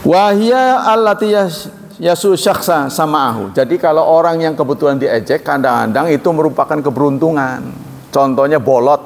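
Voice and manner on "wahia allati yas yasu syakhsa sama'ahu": slow, higher Arabic reading; measured Arabic chant; Arabic recitation. Acted. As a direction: read the text out like a scholar. Reading Arabic text slowly.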